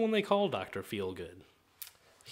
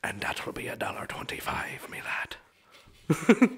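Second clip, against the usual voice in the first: put-on whispered Irish accent; slower than usual. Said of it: "that's my Irishman".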